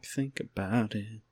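slow and quiet. Acted. Echoing the review line.

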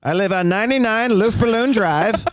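put-on Southern accent. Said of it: "talk with that accent".

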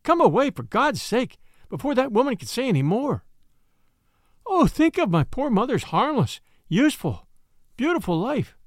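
flee her in horror; horrified. A narrator's reading. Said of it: "with a scream of horror".

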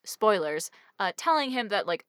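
ordinary talk

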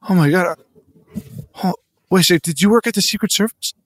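normal talking voice

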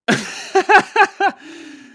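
loud, high burst of laughter. Real, not acted. Cracking up after reading the fake email, loud laugh.

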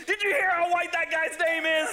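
high laughing shout from the crowd. Retold or imitated. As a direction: shout it, half laughing, pointing at him.

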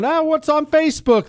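high, breezy voice of a phone checker; mock eager phone checker. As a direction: toss it off up high, like a carefree scroller. Mocking phone-checking recruit.

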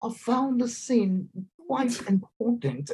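mock British actress, drawn out; lofty British accent, mocking; posh British accent. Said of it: "the most haughty-taughty British accents".

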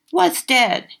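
put-on high, puzzled child's voice; a small child who has never heard the word; innocent little girl. A narrator's reading.

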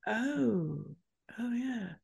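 soft, deflated client voice; sheepish realisation. Acted. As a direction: let it sink in quietly. Acted client's sheepish realisation about calls.

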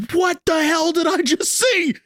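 high, shouted disbelief; mock shocked yell; stunned disbelief. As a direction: blurt it out like you can't believe your eyes. Retelling seeing the robot.

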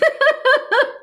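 high bright burst of laughter; real laugh. Real, not acted. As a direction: laugh it off, amused at yourself. Laughs at her own claim.

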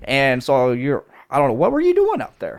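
hammed-up Midwest Wisconsin twang; Wisconsin accent. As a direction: play it like a cheery Wisconsin local. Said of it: "in a Wisconsin accent".

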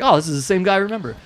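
high, fast amused aside; mock recognition. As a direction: rattle it off in delighted surprise. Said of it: "I was like".

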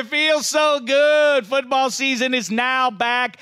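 high, loud, drawn-out host call; announcer voice. Acted. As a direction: greet the audience like a game-show host. Announcer voice continues.

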